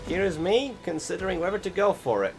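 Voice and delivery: ordinary talk, nothing marked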